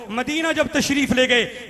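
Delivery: usual speaking voice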